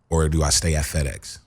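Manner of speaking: ordinary delivery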